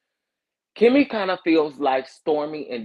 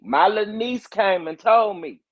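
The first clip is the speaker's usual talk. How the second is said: high-pitched imitation of a country accent; Miss Wanda; 8 semitones higher, 4 dB louder than usual. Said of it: "I love Miss Wanda's country accent".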